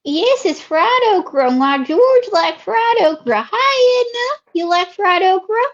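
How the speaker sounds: swooping Southern drawl; Southern lady character. Acted. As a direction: stretch the vowels like a chatty Southern belle. Host voices Ruby.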